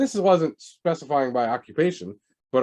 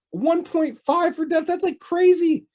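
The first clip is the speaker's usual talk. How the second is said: incredulous; 4 dB louder, slower than usual. Real, not acted. Repeats startling statistic incredulously.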